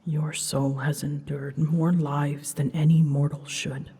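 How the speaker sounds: usual speaking voice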